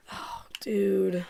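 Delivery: a hushed groan of despair; dismayed whisper. Real, not acted. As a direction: breathe it out, crushed. Team about to concede.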